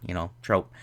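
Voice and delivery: usual speaking voice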